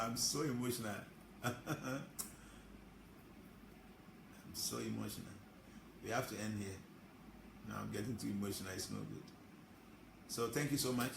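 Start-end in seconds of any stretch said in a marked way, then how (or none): 0.0-9.2 softer, lower, close to tears; emotional. Real, not acted. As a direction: let the words sink as tears come. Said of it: "I feel like sharing tears now".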